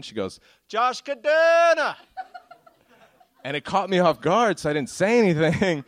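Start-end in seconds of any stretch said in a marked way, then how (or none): 0.7-1.9 put-on high, loud Jamaican accent, name stretched out; booming, high-pitched mock Jamaican caller; positive Jamaican woman. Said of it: "this positive Jamaican woman".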